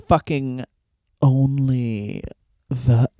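slowing, dropping to a whisper; parody tough guy. Acted. Co-host parodying the wrestler's line, slowing to whisper. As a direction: start plainly, then stretch it out into a hushed finish.

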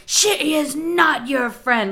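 loud, high, slow mock scolding; retold scolding scream. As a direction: hammer each word like a stern dog owner. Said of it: "she's screaming".

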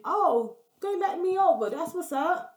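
high, pleased inner voice; thinking the truck let her merge, pleased; relieved thought. Retold or imitated. She thinks she was let over, pleased and relieved.

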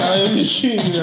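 loud, laughing attempt at an African accent. Co-host tries the king's line in African accent. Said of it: "I can't do the African voice".

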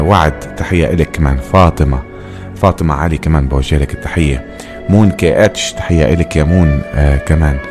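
usual speaking voice

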